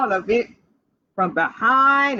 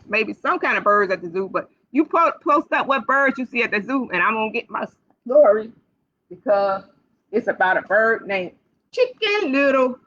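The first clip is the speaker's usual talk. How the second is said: usual speaking voice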